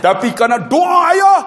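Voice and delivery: high loud cry; preacher shouting the climax to the congregation; preaching shout. Real, not acted. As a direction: hammer the point home. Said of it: "preacher shouts point".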